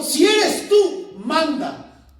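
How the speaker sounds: loud, high call across distance. Acted. Peter calls to Jesus on the water, calling out. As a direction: cry it out slowly, then drop onto the command.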